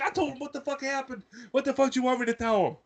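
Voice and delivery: high, indignant comeback; retold exasperation. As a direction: act out pissed-off self-defense, not shouted. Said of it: "Like, I'm pissed".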